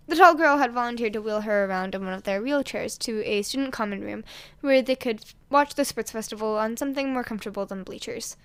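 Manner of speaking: usual speaking voice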